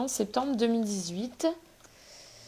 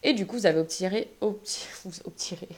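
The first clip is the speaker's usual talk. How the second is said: ordinary talk, nothing marked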